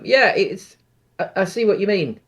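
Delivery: ordinary talk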